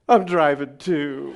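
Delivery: put-on loud, slow drunk admission; drunk confession. Drunk man admits driving to pastor, loud and slow. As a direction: drag it out, loud and sheepish. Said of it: "He goes".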